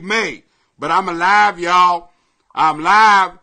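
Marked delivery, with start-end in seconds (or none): none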